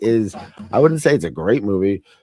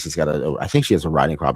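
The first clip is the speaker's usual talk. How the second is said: ordinary delivery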